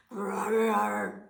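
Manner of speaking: loud rumbling bear growl. Acted. Bear growls at Baba Brena's joke, loud.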